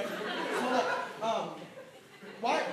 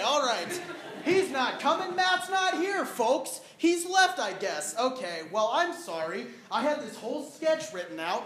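ordinary delivery

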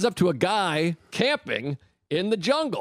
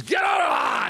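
gorilla yelling; 8 semitones higher than usual. Acted. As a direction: bark it out to chase the man away. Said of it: "And the gorilla goes".

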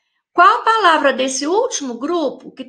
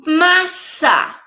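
"Massa" drawn out as mas-sa, higher pitched; syllable by syllable; 4 semitones higher, 3 dB louder than usual. Shown as a demonstration. Teacher showing pupils two syllables, syllable by syllable. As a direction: sound out "Massa" in two deliberate beats. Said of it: "Tem duas sílabas".

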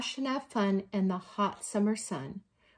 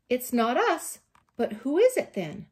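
lightly voiced bear, louder and higher; bear puzzling; 4 semitones higher, 7 dB louder than usual. A narrator's reading. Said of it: "Bear says".

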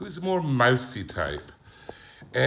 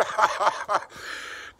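laugh; 11 semitones higher, 4 dB louder than usual. Real, not acted. Laughing at his childhood joke.

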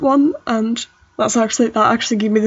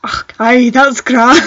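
Scottish accent, rising louder into a laugh; 2 semitones higher, 6 dB louder, much slower than usual. Shown as a demonstration. Testing grand in Scottish for a friend, Scottish accent. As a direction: put on a playful Scottish accent. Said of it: "let me just get into a Scottish accent".

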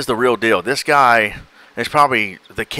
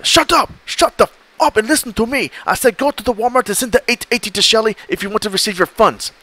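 much higher, shrill-pitched furious yelling; angry scammer; 10 semitones higher than usual. Retold or imitated. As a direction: scream at the fool on the phone.

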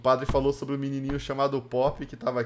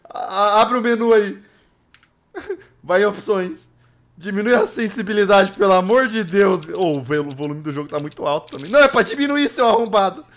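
frantic gamer; 3 semitones higher, 8 dB louder than usual. Real, not acted. Frantic requests to lower settings.

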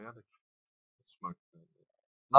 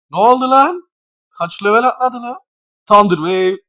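high, loud disbelief; shocked outburst; 11 semitones higher, 14 dB louder than usual. Real, not acted. Gamer stunned by the enemy Pokemon, shocked shout. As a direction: shout it like you can't believe it.

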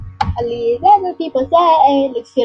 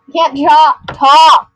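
loud exclaim; 2 semitones higher, 9 dB louder than usual. Real, not acted. Laughing can't talk.

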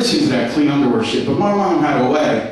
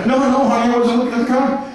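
higher, defensive protest; lightly voiced husband, higher and flustered; mock pleading husband; 6 semitones higher than usual. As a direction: plead innocence to the wife. Husband denies to wife.

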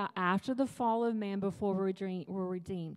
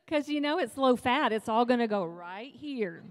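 mock tempting voice; 3 semitones higher, 7 dB louder than usual. Will's voice, mock persuasion.

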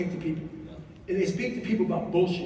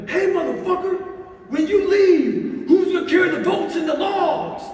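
full-throated yell; 10 semitones higher, 7 dB louder than usual. Retold or imitated. Leader yelling at the man quitting the boat crew, shouting. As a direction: holler it hard to rally a worn-out crew. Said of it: "and I yelled at him".

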